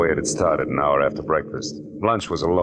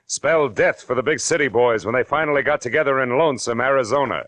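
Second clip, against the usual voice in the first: higher, punchy announcer's hook; dramatic teaser announcement; 8 semitones higher than usual. Acted. Narrator teases the listeners with a dramatic hook. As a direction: sell the mystery to the radio audience. Radio show teaser opening.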